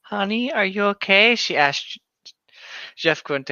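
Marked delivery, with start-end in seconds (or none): 0.0-1.3 a worried mother calling to her son; mother's voice. Acted. Reading mother's line.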